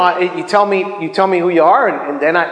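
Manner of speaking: normal talking voice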